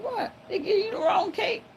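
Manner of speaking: high, cracking shocked question; shocked disbelief. Retold or imitated. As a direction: squeak it out in disbelief. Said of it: "I said".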